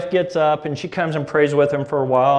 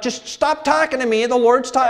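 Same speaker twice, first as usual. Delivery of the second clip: much higher, louder, cut off short; annoyed son; 9 semitones higher, 4 dB louder than usual. Retold or imitated. Son in bedroom to dad, higher louder annoyed brush-off. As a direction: shoo dad away mid-sentence.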